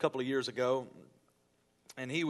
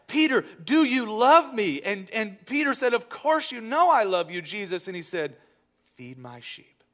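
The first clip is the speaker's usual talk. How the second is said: preaching; 3 semitones higher, 5 dB louder than usual. Acted. Pastor to congregation, rising preaching retelling. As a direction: swell up and press the third question hard. Sermon retelling of Jesus and Peter.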